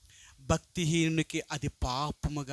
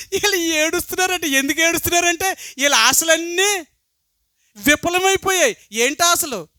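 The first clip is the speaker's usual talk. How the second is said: high, loud pulpit preaching; fiery preaching; 10 semitones higher, 8 dB louder than usual. Acted. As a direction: drive the point home to the congregation, much louder and higher. Preacher at full force. (ఉద్రేకంతో బిగ్గరగా ప్రసంగం)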